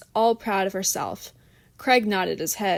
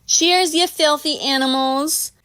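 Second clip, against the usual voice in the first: put-on higher, slow brash party-girl toast; rowdy toast; 6 semitones higher, 4 dB louder, slower than usual. Christina toasting her friends, brash and slow. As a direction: raise your glass and swagger through it.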